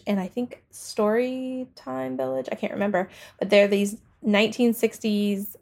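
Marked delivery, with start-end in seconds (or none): none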